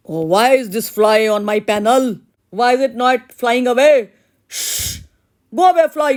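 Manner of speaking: loud, high, exasperated shooing of a fly; father shooing a fly. Acted. Painter father tries to chase the fly off his panel, shouting. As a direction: flap at the pest and yell it away.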